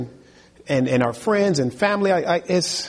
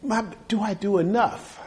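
raised, quiet asking; a quiet, uncertain question to oneself; searching self-question; 5 semitones higher, 6 dB quieter than usual. Acted. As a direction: ask yourself softly, not yet pleading. Preacher asks himself, building.

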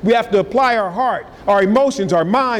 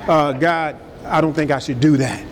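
lower, hesitant acted reply; meek answer; 5 semitones lower than usual. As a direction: give in humbly, pitched down. Believer answers the warning meekly.

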